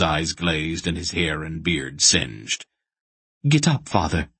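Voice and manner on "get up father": urgent. A narrator's reading. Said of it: "Aunt Paul said urgently".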